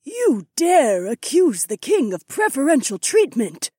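slow, high, imperious challenge; icy tone. A narrator's reading. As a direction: ask it coldly, weighing every word. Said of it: "asked in an icy tone".

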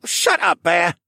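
put-on high, loud snapping boy. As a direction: snap back at your brother. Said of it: "Viken snapped back".

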